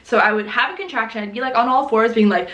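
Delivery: ordinary delivery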